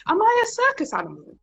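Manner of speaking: high, put-upon outrage; mock indignant. Thinking about gawking colleagues, mock indignant. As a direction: scoff at being treated like a show. Indignant inner thought retold.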